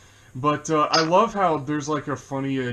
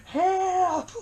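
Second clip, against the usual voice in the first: high yelped cry for help, hammed-up. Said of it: "the way he screams".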